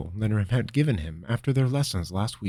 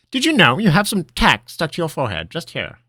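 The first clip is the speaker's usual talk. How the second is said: put-on teasing old wizard; 10 semitones higher, 7 dB louder than usual. Master teasing about forehead.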